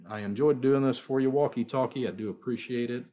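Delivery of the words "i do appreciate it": usual speaking voice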